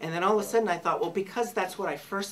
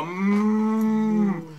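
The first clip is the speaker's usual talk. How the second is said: mock moo; 5 semitones higher, 9 dB louder than usual. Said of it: "I go".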